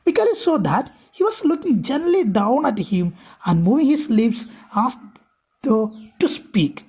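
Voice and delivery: a careful read-aloud for students; slow story reading. A narrator's reading. Teacher reading the English text aloud to her class, slow and deliberate. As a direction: read each English phrase slowly and deliberately.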